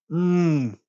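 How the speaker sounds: contented mmm after a sip of tea; satisfied sip hum. Real, not acted. Sipping tea.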